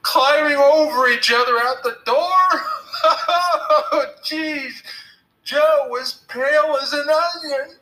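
high, loud, helpless laughter through the words; laughing. A narrator's reading. Billy gloating to Tom about the scared boys, laughing. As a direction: crow it while cracking up. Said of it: "Billy said, laughing".

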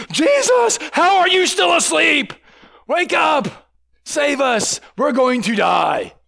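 hammed-up high, loud panic; panicked shouting. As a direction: shout in mock terror, drawing words out. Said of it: "I'm sure that's how they said it".

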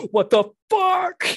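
high, loud, drawn-out shocked what the fuck; startled shout of disbelief; shocked outburst. Retold or imitated. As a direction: blurt it out in disbelief. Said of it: "I was like".

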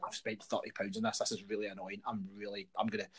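low, soft mock complaint; understated retold irritation; muted grumbling. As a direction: grumble it privately to yourself. Retells own silent fuming in cinema.

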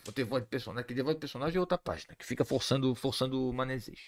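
low, soft muttering; grumbling aside. Real, not acted. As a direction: grumble it under your breath. Grumbling aside about forced character voices. (resmungo baixinho)

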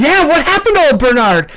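heckler. Acted. Heckler in back asks.

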